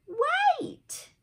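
high, loud, sudden call out; excited call. Acted. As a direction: stop him, he's getting away. Calling after the running tiger.

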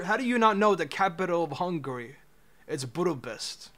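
ordinary talk, nothing marked

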